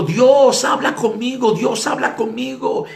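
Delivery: high, loud challenge to God; shouting. Retold or imitated. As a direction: call out insistently at the top of the voice. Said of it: "empezó a gritar".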